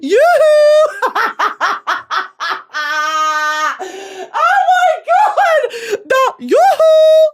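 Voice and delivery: high, loud, squealing, laughing holler; hollering in a forest. Pretend. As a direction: wave him over, giggling wildly. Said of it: "Me to Jay Ellis".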